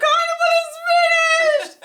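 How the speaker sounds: high, slow hammed-up wail; mock wailing. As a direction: cry it out like a heartbroken kid. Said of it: "I said".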